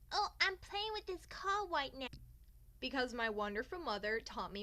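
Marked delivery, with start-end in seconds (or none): 0.1-2.1 put-on high, sugary polite kid voice; sweet little girl voice. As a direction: play the prim, well-mannered little girl, pitched up. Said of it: "I was like".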